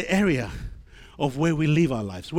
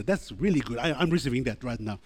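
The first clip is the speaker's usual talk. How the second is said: casual aside; 6 semitones lower, 5 dB quieter than usual. Shown as a demonstration. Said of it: "High five somebody today and say".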